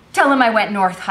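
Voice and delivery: sudden urgent burst; urgent gasp. A narrator's reading. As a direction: gasp it out loudly as you flee. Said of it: "I gasped".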